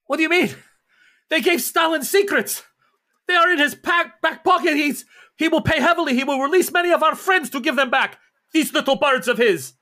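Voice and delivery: high-pitched, loud indignant outburst; agitated character. Acted. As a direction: rant in a strained high voice. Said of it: "he's like".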